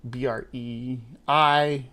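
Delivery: ordinary delivery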